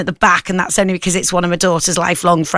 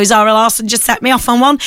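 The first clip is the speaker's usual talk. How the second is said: ordinary delivery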